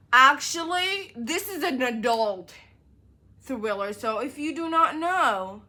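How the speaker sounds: ranting at readers about adult thrillers; exasperated lecture. Real, not acted. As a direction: tell them off for complaining. Lecturing the commenters.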